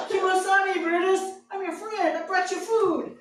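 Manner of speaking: high, loud, panicked pleading with a snarling dog; loud, high, scared appeasing; frantic plea. Retold or imitated. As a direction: beg the dog in a rising panic.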